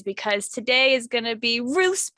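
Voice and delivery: ordinary delivery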